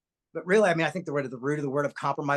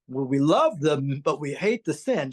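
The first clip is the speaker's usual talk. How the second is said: imagined preachy mom; 3 semitones lower, 3 dB louder than usual. Retold or imitated. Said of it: "I thought maybe she would be like".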